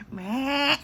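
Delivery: high, loud bleated fake sob; mock crying bleat. Shown as a demonstration. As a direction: let out an obviously fake wail. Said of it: "you guys know my fake cry".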